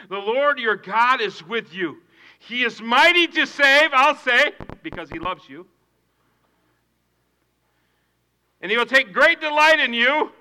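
loud, high proclaimed scripture; preached verse. Acted. Pastor reading Zephaniah to the congregation. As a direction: ring the verse out.

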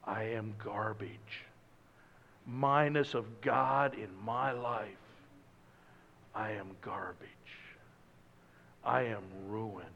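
hushed repentant prophet; contrite lament. Retold or imitated. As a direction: speak as a broken man before God. Isaiah voiced in despair.

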